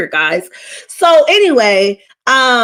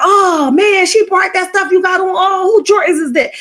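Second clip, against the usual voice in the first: higher, teasing mock voice of the hype man; trolling hype man; 7 semitones higher than usual. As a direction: needle him like a heckler. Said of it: "the guy was trolling".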